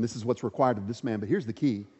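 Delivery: ordinary talk, nothing marked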